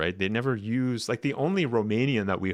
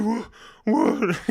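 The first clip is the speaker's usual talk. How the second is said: high, loud mock character voice; hammed-up, high-pitched impression of him; mimicking his speech; 12 semitones higher, 4 dB louder than usual. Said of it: "wasn't that how he spoke?".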